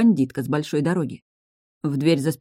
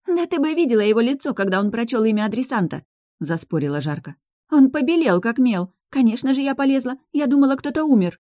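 excited arguing; 7 semitones higher than usual. Acted. Inessa argues excitedly.

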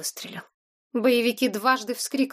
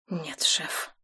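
low, soft, beaten; cowed mumble; 5 semitones lower, 6 dB quieter than usual. A narrator's reading. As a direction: mumble it, head down. Guard repeats, cowed.

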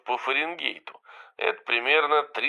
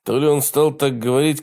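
plain reading voice

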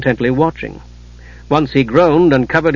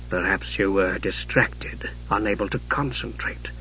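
low, slow, very soft reproach; quiet, menacing sorcerer uncle, low and unhurried; cold mage; 6 semitones lower, 12 dB quieter than usual. A narrator's reading. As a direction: chide softly, stretching each word. Raistlin voiced, cold-eyed insinuation.

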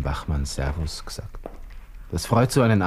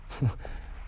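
soft bitter laugh; 9 dB quieter than usual. Acted. Bitter laugh at imagined revenge.

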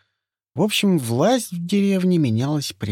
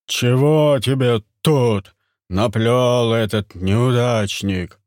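heavy, menacing chief; gruff hunter voice; 5 dB louder, slower than usual. Acted. Turgam voiced by narrator.

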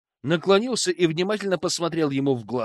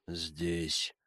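emotionless; 10 semitones lower, 13 dB quieter than usual. Acted. Hypnotized Sam answers flatly.

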